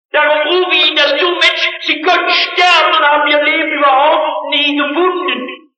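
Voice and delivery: high, loud revival preaching, pressing hard; fiery pulpit appeal, rising and pressing; preaching at full force. Acted. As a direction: call it out to the whole hall like a revival preacher. Preacher calls out to congregation.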